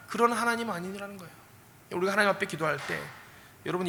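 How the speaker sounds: ordinary talk, nothing marked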